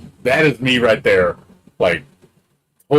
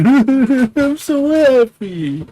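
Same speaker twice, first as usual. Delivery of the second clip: high, slow, stretched-out happy wail; mock tearful squeal; 5 dB louder, much slower than usual. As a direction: wail it like crying tears of joy on stream. Imagined stream clip of him crying.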